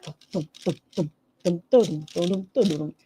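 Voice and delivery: rhythmic "dum dum" footfalls; imitated chasing noise. Acting out Ambi chasing Emily, imitated running noise. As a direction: drum out someone running after another. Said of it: "and Ambi's like".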